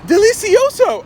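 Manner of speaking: put-on high, delighted toddler voice. Toddler heard through locked door, high delighted voice. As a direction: savour it like a little kid. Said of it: "I hear her go".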